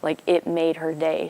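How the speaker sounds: normal talking voice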